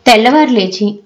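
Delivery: plain reading voice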